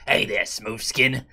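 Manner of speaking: low slow gravelly sneer; ghoul sneer. Retold or imitated. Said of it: "made a slur ... called".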